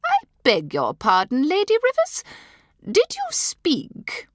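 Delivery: amazed disdain. A narrator's reading. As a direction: feign shock at being spoken to.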